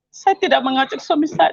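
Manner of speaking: usual speaking voice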